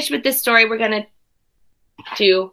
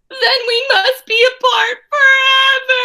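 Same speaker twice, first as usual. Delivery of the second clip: mock wail; 10 semitones higher than usual. Gerald wailing in drama.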